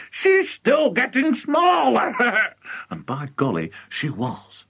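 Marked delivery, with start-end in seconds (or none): 0.1-2.9 high, drawn-out crowing with a laugh; delighted high-pitched cackling; gleeful shout. A narrator's reading. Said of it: "shouted Mister Cranky gleefully".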